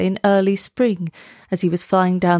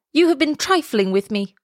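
higher-pitched, understated outburst; a touch of outcry, pitch raised; mild indignant cry; 6 semitones higher than usual. A narrator's reading. Swallow accusing the reed, mild indignant cry. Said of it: "he cried".

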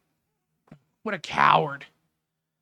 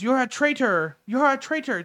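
mild mock yell; 6 semitones higher than usual. Host retelling the heckler's attack on Christina, mild mock yell. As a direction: mimic an accuser calling out, without full volume. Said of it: "He yells out".

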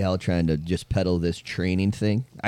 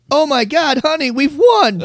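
much higher, louder squeal of fake joy; mock excitement; 6 dB louder than usual. Pranking the sweepstakes caller in the car, mock thrilled squeal. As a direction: overplay winning the sweepstakes. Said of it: "I said".

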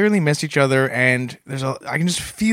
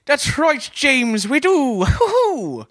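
put-on a light Irish accent, higher, with a hooting laugh; 10 semitones higher, much slower than usual. Greeting his cohost for Saint Paddy's Day in a light Irish accent. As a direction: play a jolly Irish lilt. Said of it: "What accent was that?".

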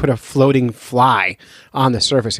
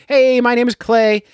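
higher-pitched, lightly acted caller greeting; sample caller; 10 semitones higher than usual. Shown as a demonstration. Host showing listeners how to leave a message, lightly acted caller. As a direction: call in with a raised, mock phone-in hello. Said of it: "you would call in and you would say".

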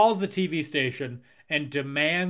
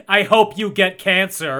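loud, higher, spiteful outburst; aggressive curse; 4 semitones higher, 9 dB louder than usual. Acted. Spurned fan turning on a celebrity, loud and aggressive. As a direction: lash out suddenly at full volume. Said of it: "It was so aggressive".

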